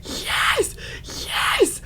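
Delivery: breathless whispered yes; excited whisper. Retold or imitated. Fan whispering her delight at the offer. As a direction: whisper it like you can't believe it.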